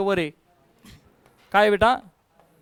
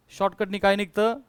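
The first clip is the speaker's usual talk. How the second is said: ordinary talk, nothing marked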